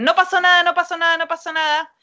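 high, loud "no pasó nada" called out in alarm; retold alarmed shout. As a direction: shout it like everyone around a fallen child. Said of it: "todos gritan". (grito alarmado, alto y fuerte)

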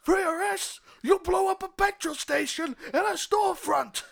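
loud, high disbelieving captain yelling; outraged captain. Acted. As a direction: blow up at the reckless cop. Captain yells at cop.

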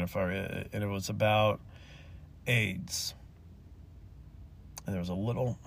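real choked-up telling, low and soft; near tears. Real, not acted. Telling of the little girl without crying, near tears. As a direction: voice sinking as the tears threaten. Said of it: "try to get this out without crying".

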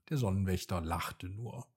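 usual speaking voice

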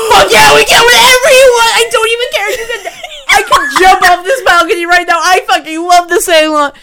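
high, loud, overjoyed yelling with a gasp; ecstatic shout. Retold or imitated. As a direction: scream with joy at the favourite movie. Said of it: "And I literally was like".

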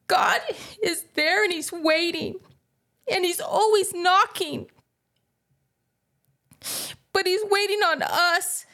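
pleading through tears with the listeners; real tears pushing the voice up; emotional. Real, not acted. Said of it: "sorry to get emotional".

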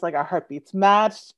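ordinary talk, nothing marked

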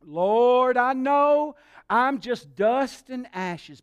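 drawn-out, hammed-up humble plea; laying it on thick. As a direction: stretch out "Lord" like a supplicant. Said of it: "He was laying it on thick".

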